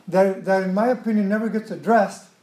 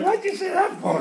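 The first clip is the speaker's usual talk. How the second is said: high-pitched accusing question; mock argumentative; 10 semitones higher than usual. Husband challenging his wife over her amen, mock argumentative. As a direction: act out a spouse picking a fight, pitched up. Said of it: "And then the argument starts".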